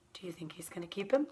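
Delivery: softly whispered question, fast. Shown as a demonstration. Teacher whispers the prediction question to listeners. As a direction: lean in and whisper it to the kids.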